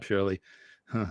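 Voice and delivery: normal talking voice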